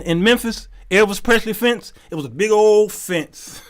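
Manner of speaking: usual speaking voice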